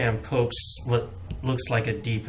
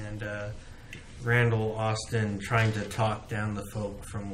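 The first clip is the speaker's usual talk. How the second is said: normal talking voice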